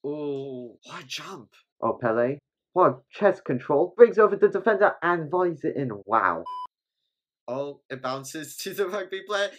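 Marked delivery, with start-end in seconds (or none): 1.8-6.4 loud, fast, higher play-by-play call; excited commentary. Real, not acted. Fan calling Pele's goal as it happens, loud and thrilled. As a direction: shout it like a live goal call.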